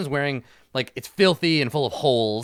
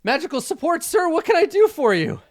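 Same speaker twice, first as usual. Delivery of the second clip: high, loud eager little wizard; eager skeleton wizard; 8 dB louder than usual. Acted. Skeleton wizard reporting to commander, high and eager. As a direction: salute and chirp it out. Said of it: "he salutes and he's like".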